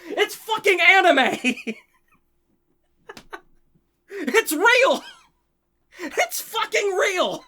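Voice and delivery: real emotional fan outburst, voice cracking; overwhelmed fan shout. Real, not acted. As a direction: shout it like joy is breaking you.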